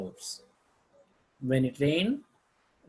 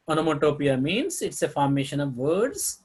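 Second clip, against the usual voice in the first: ordinary talk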